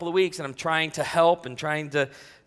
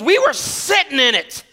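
Scott's voice; 10 semitones higher, 7 dB louder than usual. Retold or imitated. Pastor imitates Scott accusing him of rescuing the room, high and loud. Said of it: "He goes".

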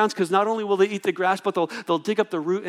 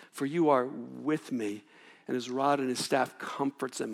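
soft scripture quote; 7 semitones lower, 9 dB quieter than usual. Shown as a demonstration. Quotes psalm softly.

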